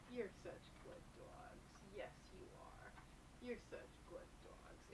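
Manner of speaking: soft unvoiced whisper of praise; hushed, whispered praise to the dogs; whispered cooing. Real, not acted. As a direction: breathe the praise tenderly to the dogs.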